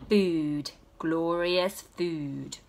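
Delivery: slow, lingering, awed; hungry dreamy wonder; savouring. A narrator's reading. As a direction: draw it out like a feast revealed. Baby finally sees the food.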